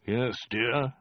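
distracted, with a rising end; absent reply. A narrator's reading. Khan buried in his maps, absent reply. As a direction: say it with your mind elsewhere. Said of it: "said Khan absently".

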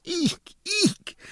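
very high delighted eek; girlish shriek. Acted. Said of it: "shrieked some more".

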